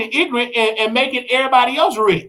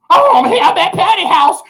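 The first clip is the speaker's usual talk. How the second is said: high, loud, laughing fan voice; mock starstruck; 10 semitones higher, 5 dB louder than usual. Speaker mocking a fan at Patty's house, high and giddy.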